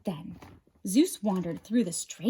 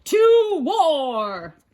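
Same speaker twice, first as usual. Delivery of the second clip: loud roaring war cry; battle cry; 7 semitones higher, 8 dB louder than usual. A narrator's reading. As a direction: roar it out.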